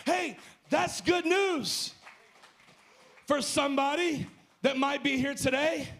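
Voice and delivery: rising, shouted preacher's climax; preaching at full force. Acted. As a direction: build the line to a shout and land it slowly.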